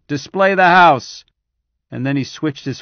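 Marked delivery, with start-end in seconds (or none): 0.1-1.2 slow, much louder, higher command; commanding order. Acted. General ordering staff, slow loud command. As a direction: spell out each word as an order. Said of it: "he ordered".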